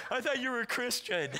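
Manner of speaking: imitating a kid sobbing; hammed-up sobs round a hurt complaint; mock crying. Crying kid reproaches the preacher over hunting, mock crying. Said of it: "this kid started crying".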